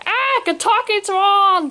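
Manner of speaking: high, drawn-out startled cry; storybook shriek of fright; alarmed. A narrator's reading. Kidnapper startled by talking swan.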